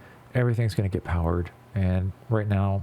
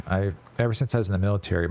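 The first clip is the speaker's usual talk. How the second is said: ordinary talk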